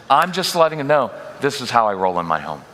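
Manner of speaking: ordinary talk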